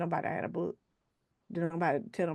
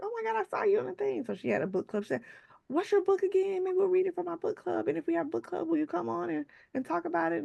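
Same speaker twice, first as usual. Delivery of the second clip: much higher, eager and bubbly; excited coworker; 12 semitones higher than usual. Retold or imitated. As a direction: gush like a fan asking a favor.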